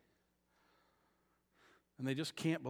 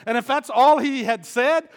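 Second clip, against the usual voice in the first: loud, higher preaching voice; preaching at full force; 10 semitones higher, 15 dB louder than usual. Acted. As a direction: preach it hard to the congregation.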